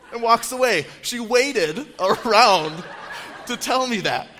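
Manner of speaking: laughing while telling it; words squeezed out through laughter. Real, not acted.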